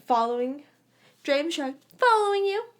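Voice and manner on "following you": put-on teasing boy. A narrator's reading. James teasing Lily.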